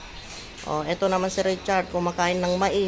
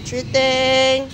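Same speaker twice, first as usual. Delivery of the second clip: higher, sing-song coaxing voice throughout; 5 semitones higher than usual. Real, not acted. Caregiver cooing at elderly Sweetie, higher sing-song baby talk.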